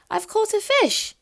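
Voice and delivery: high, thrilled squeal of a catch; a girl yelping as the line tugs; excited cry. Acted. Little girl on a boat, thrilled at her catch: excited cry. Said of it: "she cried excitedly".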